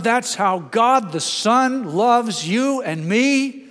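loud, high, slow preaching climb; preaching at full force. Acted. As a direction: lift the room with every phrase.

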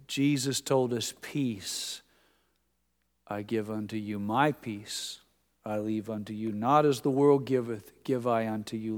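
soft, low recitation of scripture. Retold or imitated. Pastor quoting Jesus on peace to the congregation, soft scripture recitation. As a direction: recite the verse gently, pausing between phrases.

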